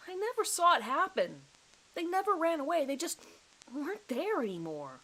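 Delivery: high, strained, near panic; pleading to be believed, scared stiff; frightened pleading. Acted. Suspect pleading with the detective, high and frightened.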